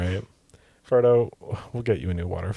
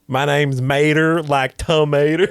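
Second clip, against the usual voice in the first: Mater voice; 5 semitones higher, 5 dB louder than usual. Pretend. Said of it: "Mater doesn't sound like a nerd".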